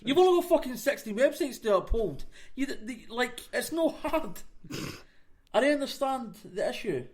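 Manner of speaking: high-pitched, incredulous griping; exasperated rant. Real, not acted. Ranting about badly designed websites, exasperated. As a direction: complain in disbelief, pitch riding high.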